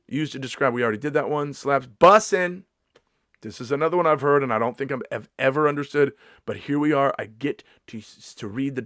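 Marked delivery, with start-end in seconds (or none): 1.5-2.6 slow, high, loud "Slaps, bussin'"; loud, stretched hype; hyped. Pretend. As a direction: drag out the words, hyped. Hyped slang.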